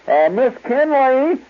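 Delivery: high, slow, loud call across the store; flustered salesgirl calling her supervisor. Retold or imitated. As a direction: holler for the other clerk, stretched out. Clerk calls for help.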